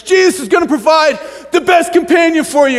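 high, excited hard sell; hammed-up promise to a lonely friend; mock evangelizing. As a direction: proclaim it like an overeager church member. Church members selling Jesus to friends.

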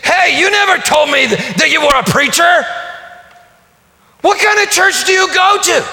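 high, loud, accusing shout; Harry challenges the preacher, a high loud shout; hostile challenge. Retold or imitated. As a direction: confront the preacher hard. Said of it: "with some hostility".

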